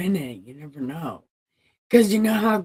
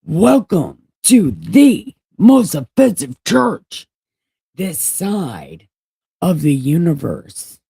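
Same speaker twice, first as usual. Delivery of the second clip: grand announcement; 10 dB louder than usual. Pretend. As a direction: proclaim it like a grand sign. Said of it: "It said".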